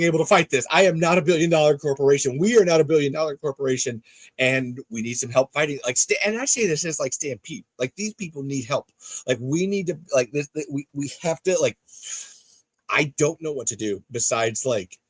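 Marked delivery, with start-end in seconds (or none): none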